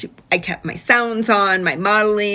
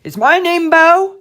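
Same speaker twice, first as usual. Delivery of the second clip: higher, louder, teasing rise on Bo; playful mock question; 9 semitones higher, 6 dB louder than usual. Therapist teasing the child about saying Bo, playful. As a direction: pretend to be puzzled by the wrong name.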